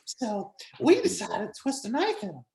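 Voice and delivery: high, hammed-up Warren voice; Warren impression. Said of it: "can you do a Warren?".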